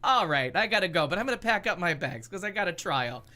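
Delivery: loud brash New Yorker voice; hammed-up New Yorker packing up; New York accent. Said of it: "he's got the New York accent".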